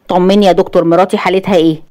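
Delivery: ordinary delivery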